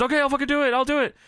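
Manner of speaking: high, riled-up agreement; hammed-up acceptance. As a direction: snap back yes like a goaded heel. Voicing wrestler accepting challenge.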